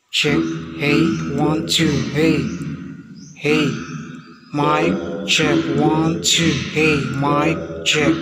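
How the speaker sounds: echo voice changer. Shown as a demonstration. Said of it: "pang-apat na voice changer".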